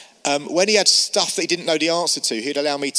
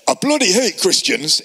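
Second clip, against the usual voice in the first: shaky Scottish accent attempt, higher; 4 semitones higher, 4 dB louder than usual. Sales rep passing a church, attempted Scottish accent. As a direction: imitate a Scot badly, raising the pitch. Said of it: "it's a Scottish accent".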